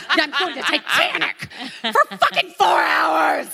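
laughing through a sarcastic grumble; half-laughing, exasperated; a laughing gripe about the long movie; laughing mock complaint.

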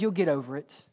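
a calm, unimpressed father brushing it off; God's dry reply. Retold or imitated. Pastor voicing God answering his tantrum, calm and low. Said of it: "God says".

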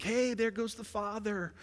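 high, chirpy mock greeting to God; mock casual worshipper. Preacher mimics how we pray, mock high voice.

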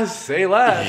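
high-pitched laugh into the catchphrase; mock douchey laugh. Imagined girl at a bar answering a drink offer, mock douchey laugh. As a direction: cackle high, then deliver the line as a put-on. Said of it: "And she goes".

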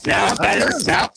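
distorted, glitchy call audio; robot voice. Real, not acted. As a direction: voice breaking up over a bad connection. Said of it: "you sound like a robot right now".

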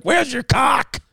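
high shouted mock question; a high, shocked shout. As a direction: yell it up high in disbelief. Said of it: "I was like".

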